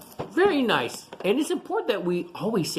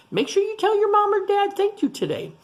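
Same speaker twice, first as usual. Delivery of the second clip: high, bright urging; eager reminder. Real, not acted. Teacher to class, much higher eager reminder. Urging kids to thank parents.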